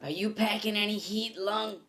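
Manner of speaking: gruff cop voice. Acted. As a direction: play the brash cop.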